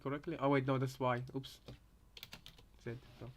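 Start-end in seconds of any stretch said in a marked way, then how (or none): none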